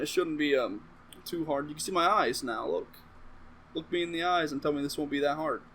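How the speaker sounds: ordinary delivery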